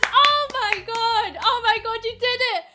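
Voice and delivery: high, loud, hammed-up delight; mock excited cheering. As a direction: cheer like you cracked a huge case. Mock celebrating solving the puzzle.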